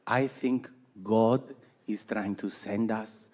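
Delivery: slow, soft, hushed imitation of mom; a mother almost whispering about God's message. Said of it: "And she goes".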